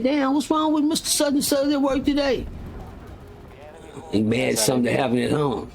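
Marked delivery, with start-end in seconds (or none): 0.0-2.4 coworker wondering. Retold or imitated. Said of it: "Be like".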